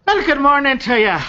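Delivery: high, bright Irish greeting; cheery Irish rogue saying good morning; Irish lilt. Acted. GM as Lucky greets the party in an Irish lilt.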